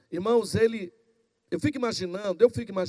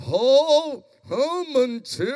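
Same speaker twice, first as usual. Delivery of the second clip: operatic, drawn-out tenor intoning; mock prayer voice; slower than usual. Shown as a demonstration. Preacher mimicking people whose voice changes in prayer, mock-tenor intoning. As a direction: swell into a pompous pious wail. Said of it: "Mas de repente muda a voz".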